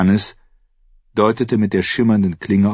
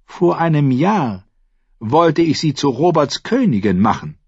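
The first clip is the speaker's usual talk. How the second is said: higher, lighter younger-brother voice; Renly retorting; 9 semitones higher, 4 dB louder than usual. A narrator's reading. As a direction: counter the accusation, pitched a little high. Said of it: "entgegnete Renly". (mit hellerer Stimme entgegnen)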